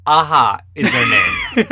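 loud, high and slow; shouting. Real, not acted. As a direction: announce it with emphasis. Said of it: "correcting the song name".

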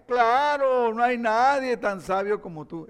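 loud, high mock admiration; gushing sarcastic agreement; sarcasm. Shown as a demonstration. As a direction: pile on insincere praise. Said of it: "Eso es sarcasmo".

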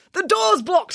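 high sharp snap; snapped. Acted. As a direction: snap at the others in a hurry. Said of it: "snapped Bruce".

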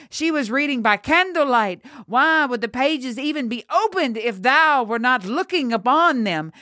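high, swooping accusation; accusing shout. A narrator's reading. Farmer who found her, high accusing voice. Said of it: "a farmer shouted".

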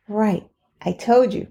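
ordinary delivery

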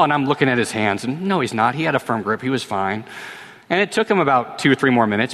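ordinary delivery

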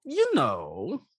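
lightly voiced lonely crab; a hint of a needy crab; crab character voice.

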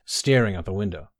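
usual speaking voice

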